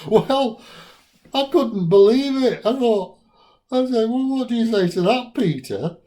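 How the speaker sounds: high, loud incredulous outburst; high-pitched, loudly flabbergasted retort; loud disbelief. Retold or imitated. Astonished reaction to the second-hand coffin.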